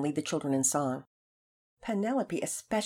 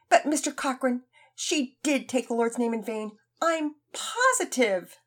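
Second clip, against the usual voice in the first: put-on high, loud pleading boy; desperate outburst; 6 semitones higher, 4 dB louder than usual. Boy desperately insists to the teacher. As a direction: beg to be believed. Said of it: "burst out".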